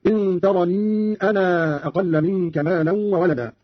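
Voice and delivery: ترني and أنا with very long final vowels; separated madd. Shown as a demonstration. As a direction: slowly stretch "taraniii" in ترني, "anaaa" in أنا. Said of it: "مدا منفصلا هكذا".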